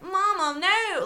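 high, loud twangy wail; southern twang. Retold or imitated. Mimicking the film's heroine at times, southern twang. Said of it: "like, a kind of twang".

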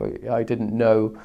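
normal talking voice

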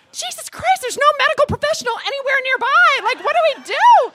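high, loud frantic alarm; panicked outburst. Retold or imitated. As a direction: raise the alarm, pitch way up. Panic with no medical help.

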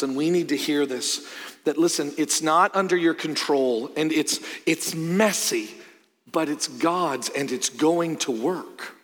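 usual speaking voice

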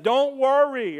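high, loud confident call; hollered assurance. Acted. Teen on truck reassuring friend on bank, called out. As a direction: call back across the water.

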